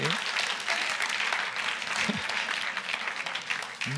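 airy unvoiced laughter, falling away; breathy laugh. Real, not acted. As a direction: let out a long breathy laugh. Laughing at his joke.

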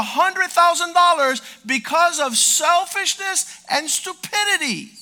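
high, loud fiery sermon; full-force preaching. Acted. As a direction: preach it hard to the congregation.

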